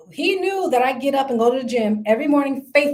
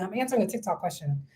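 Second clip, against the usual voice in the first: quiet aside; 5 semitones lower, 9 dB quieter, faster than usual. Real, not acted. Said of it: "I'm not crazy, y'all".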